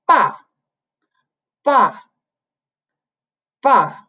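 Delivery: ba dropping sharply; falling tone. Shown as a demonstration. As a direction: fourth tone falling ba. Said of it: "descending line".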